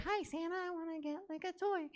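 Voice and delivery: put-on tiny high, soft child voice; squeaky little-kid impression. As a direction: imitate a little kid asking Santa. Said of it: "the first little kid was like".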